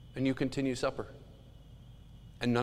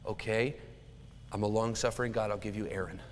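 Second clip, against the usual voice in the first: patient God; 5 semitones lower, 6 dB quieter than usual. Acted. God answers Moses with patience.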